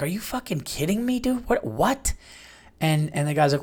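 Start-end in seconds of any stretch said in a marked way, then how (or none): 0.0-2.1 put-on disbelieving boyfriend. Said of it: "And he's like".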